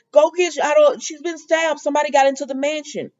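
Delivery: a retold frantic plea for help; panicked. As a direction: blurt it out in a frantic rush. Chad panicking over stabbed Abigail.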